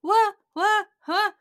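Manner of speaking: high, loud mock confusion; clueless daughter. Daughter baffled by mom's questions, mock clueless. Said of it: "And the daughter's like".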